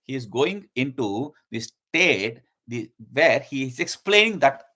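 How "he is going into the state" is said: normal talking voice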